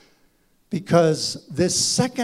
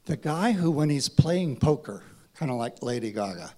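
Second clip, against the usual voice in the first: usual speaking voice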